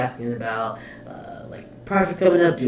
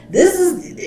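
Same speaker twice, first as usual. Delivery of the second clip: very high, loud incredulous start; amused disbelief; 7 dB louder than usual. Real, not acted. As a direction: burst out, overwhelmed and high-pitched. Overwhelmed, amused by the endless talking.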